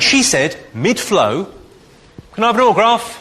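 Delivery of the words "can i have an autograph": put-on higher, louder girly ask; lightly voiced cheeky fan; mock girl's request. Comic voices the squatting girl asking for an autograph, higher.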